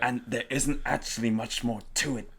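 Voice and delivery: put-on halting Kingpin voice; Kingpin impression. Critic mimicking an actor's one-note delivery, Kingpin impression. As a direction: mimic an actor coasting through every line. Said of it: "says every line like this".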